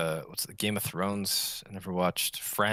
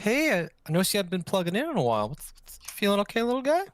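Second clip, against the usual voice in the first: high and fast, gently concerned; high caring voice; 11 semitones higher, 4 dB louder, faster than usual. Pretend. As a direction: coax a sad little robot.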